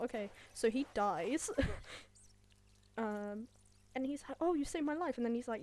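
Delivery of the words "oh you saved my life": higher-pitched imitated thanks; hammed-up grateful quote; mock gushing gratitude. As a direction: play up being rescued, pitched up. Acting out the rescued character.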